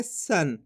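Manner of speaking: सन ending in a half न, pitch dropping; half-n nasal. Shown as a demonstration. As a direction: say सन as one syllable closed on n. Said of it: "आधा ना के रूप में इसका उच्चारण निकालेंगे".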